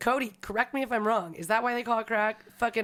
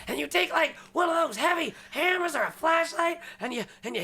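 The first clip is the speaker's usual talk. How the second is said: high mock crackhead voice; 7 semitones higher than usual. Retelling the crackhead's hammer step, high mock voice. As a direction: lay out the steps like the wild storyteller. Crackhead telling drug story.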